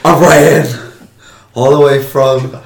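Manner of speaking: urgent panting report. Pretend. Running in, out of breath.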